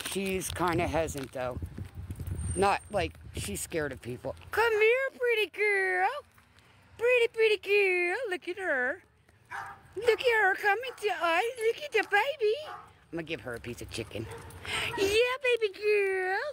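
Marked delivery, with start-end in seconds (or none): 4.5-12.5 much higher sing-song pet voice. Real, not acted. Owner coaxing a timid dog closer in high baby talk. 15.0-16.4 much higher sing-song pet voice. Real, not acted. Owner coaxing a timid dog closer in high baby talk.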